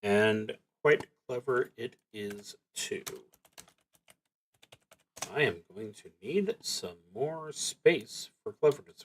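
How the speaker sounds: normal talking voice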